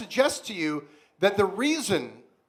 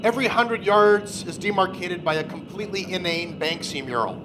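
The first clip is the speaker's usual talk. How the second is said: dramatic narration; 3 semitones lower than usual. Acted. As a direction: narrate it like a grim fantasy epic.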